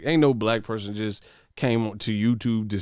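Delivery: normal talking voice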